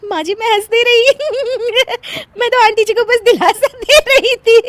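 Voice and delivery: high, loud squeal through laughter; denying while laughing. Acted. Daughter-in-law excusing herself at the mourning, laughing helplessly. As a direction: plead innocence while cracking up. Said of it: "हंस क्यों रही थी तू".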